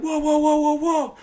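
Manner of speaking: high rapid-fire whoas; high mock panic; alarmed. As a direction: imitate someone losing their footing. Man being shoved down stairs.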